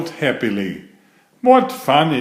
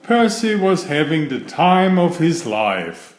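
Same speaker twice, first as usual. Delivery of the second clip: ordinary delivery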